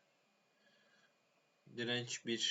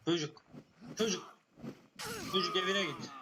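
shout; 6 semitones higher than usual. Real, not acted. Shouting at child NPC. (çocuğa bağıran azar)

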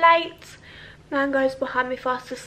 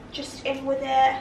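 ordinary talk

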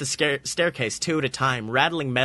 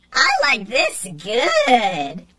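very high squeak, drawn out slowly; slow high creature voice; 9 semitones higher, 4 dB louder, much slower than usual. A narrator's reading. Robot enjoying the Roomba, slow high squeak. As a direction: savour each word like a happy toy.

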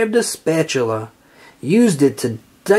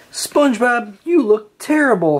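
exclaiming; 7 semitones higher than usual. A narrator's reading. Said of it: "Sandy exclaimed".

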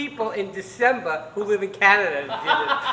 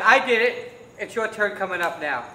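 ordinary delivery

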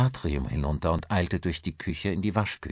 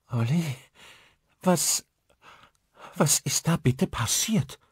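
high, upset woman faltering over her words; distraught; 10 semitones higher than usual. A narrator's reading. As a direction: ask in high-pitched bewilderment, halting. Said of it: "Caros ziemlich verstörte Stimme". (verstört, stockend und hoch)

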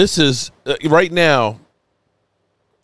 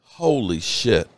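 overcome with emotion; 6 semitones lower, 5 dB quieter, slower than usual. Real, not acted. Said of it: "I'm sorry if I'm a little emotional".

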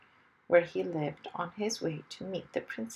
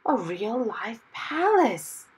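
awed excitement; 3 semitones higher, 3 dB louder, slower than usual. Acted. Excited at palace.

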